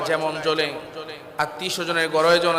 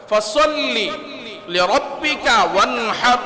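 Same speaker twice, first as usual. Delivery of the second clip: Quran recitation; 4 semitones higher, slower than usual. Acted. Quoting Quran verse, recited.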